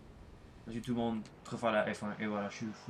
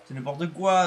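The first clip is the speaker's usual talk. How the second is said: mock outrage; 5 semitones higher, 6 dB louder than usual. Mocking nascar doubters, raised voice.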